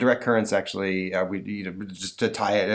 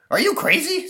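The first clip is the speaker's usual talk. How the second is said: put-on high, loud disbelieving boy; incredulous kid; 6 dB louder than usual. Boy refusing the monster's offer, high and shocked. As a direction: recoil at the idea.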